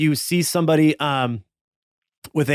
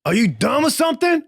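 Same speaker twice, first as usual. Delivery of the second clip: mocking sneer; 10 semitones higher, faster than usual. Rival mocks player's cowboy.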